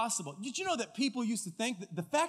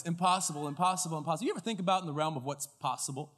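ordinary talk

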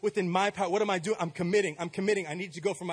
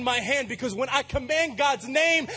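loud, higher climactic preaching; full-force preaching; 9 semitones higher, 4 dB louder than usual. Acted. Pastor rallying the church, loud and higher. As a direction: build the congregation up with fire.

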